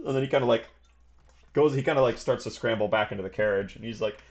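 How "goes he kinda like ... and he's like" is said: ordinary talk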